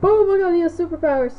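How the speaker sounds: high, loud amazed exclaim; excited exclaim. Real, not acted. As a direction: gush with amazement. Amazed at game character.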